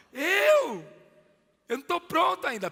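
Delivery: high, loud startled cry; startled protest. Acted. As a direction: cry out in disbelief that you're ready. Pedro protests he is unready.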